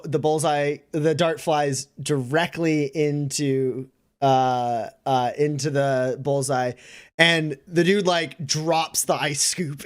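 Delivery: ordinary talk, nothing marked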